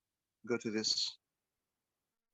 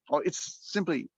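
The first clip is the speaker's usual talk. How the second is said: normal talking voice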